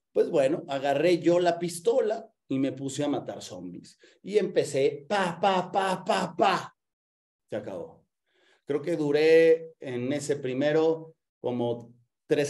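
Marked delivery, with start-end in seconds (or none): 5.1-6.8 short burst of higher-pitched "pa" gunshot noises; vocal pew-pew of a toy gun; imitated gunfire. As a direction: mimic rapid pistol shots with the mouth. Imitates own shooting in game.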